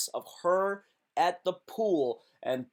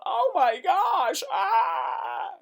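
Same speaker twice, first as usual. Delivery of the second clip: high, loud, slow mock squeal; hammed-up fangirl shriek; over-the-top imitated screaming fans; imitating fans freaking out; 5 dB louder, much slower than usual. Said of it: "People were going".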